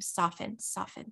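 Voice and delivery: soft self-talk, fading to a whisper; quiet self-reminder. Retold or imitated. Mother reminding herself to relax, hushed self-talk.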